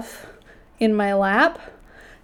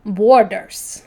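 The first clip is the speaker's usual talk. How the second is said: light mock Russian accent, "Borders" said a touch louder as "Worders"; mock Russian accent on "Borders", the B close to a W; Russian accent imitation; 7 dB louder than usual. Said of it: "has a very strong Russian accent".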